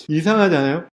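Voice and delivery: ordinary talk, nothing marked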